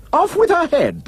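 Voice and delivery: high, commanding cry; roaring. A narrator's reading. Prince orders the sister's beheading, roaring. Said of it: "the prince roared back".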